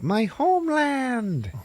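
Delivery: high, long drawn-out cry; hammed-up homecoming wail; mock joyful cry. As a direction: stretch it out high in rapture. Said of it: "he's like".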